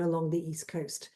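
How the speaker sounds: ordinary talk, nothing marked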